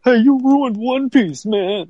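high, drawn-out mock complaint; aggrieved caller. Fan calls the bartender to hassle him, mock aggrieved caller. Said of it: "some guy calls up and they're like".

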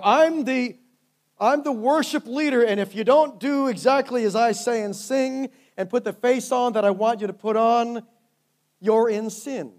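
high, loud, self-important leader voice; hammed-up controlling worship leader; mock bossy worship leader. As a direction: puff yourself up and demand obedience.

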